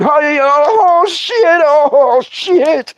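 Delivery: very high, drawn-out comic yelp; mock panicked hollering. Man hopping away from a biting snake, mock panicked holler. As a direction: holler it like you're dancing away from fangs.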